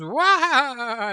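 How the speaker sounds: high, wailing mock neigh; long high "wah" of a horse; imitated horse whinny. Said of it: "the horse goes".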